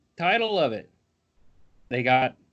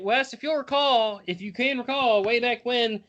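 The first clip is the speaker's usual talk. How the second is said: ordinary talk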